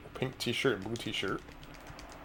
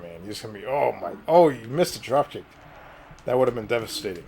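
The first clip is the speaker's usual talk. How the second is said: ordinary talk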